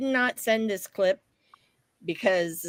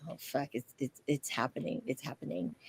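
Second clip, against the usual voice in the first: much softer, nervous murmur; nervous hush; 5 semitones lower, 13 dB quieter than usual. Retold or imitated. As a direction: whisper to yourself as lights dim. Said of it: "I'm like".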